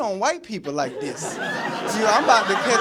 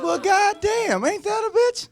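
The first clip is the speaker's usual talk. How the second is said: much higher, slowly drawn-out exclamation; mock surprised outrage; 12 semitones higher, slower than usual. Comedian retelling his reply to mom's stripper joke, high mock outrage. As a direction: play up the disbelief, stretching it high.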